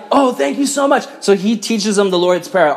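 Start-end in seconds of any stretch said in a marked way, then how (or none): 0.1-1.1 high-pitched, acted gush of thanks; voiced high in naive gratitude; grateful gushing. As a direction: play a simple hermit, pitched way up, thankful. Hermits gushing thanks to priest.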